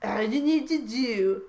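slow, hammed-up seducer; Donald Trump. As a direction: draw out each word as comic persuasion. Voices Trump seducing in fan fiction.

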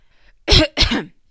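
loud, hammed-up fake cough. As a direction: cough on purpose for a laugh. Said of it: "That was a fake cough".

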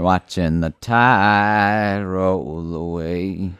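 stretched out slowly, dreamy; very slow drawl. Pretend. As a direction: let every word drag out. Drifting, dreamy line.

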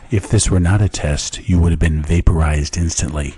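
loud mock alert announcer; emergency broadcast voice. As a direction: boom it like a siren warning.

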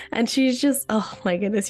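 ordinary delivery

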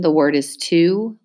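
normal talking voice

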